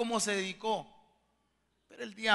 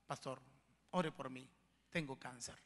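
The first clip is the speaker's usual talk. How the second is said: hushed, low, broken-hearted confession; retold tearful plea; 7 semitones lower, 13 dB quieter than usual. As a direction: quote her sorrow very softly, without sobbing. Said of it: "Y llorando ella me dice". (súplica triste, en voz muy baja)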